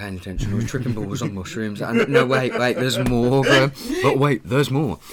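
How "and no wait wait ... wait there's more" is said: infomercial pitch voice. Pretend. Infomercial catchphrase shouted twice.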